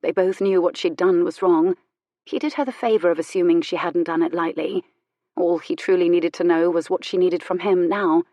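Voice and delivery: plain reading voice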